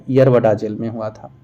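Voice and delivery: ordinary talk, nothing marked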